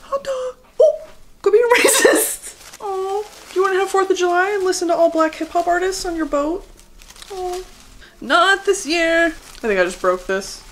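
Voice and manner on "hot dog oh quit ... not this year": mock scolding. Mocking an imagined patriot.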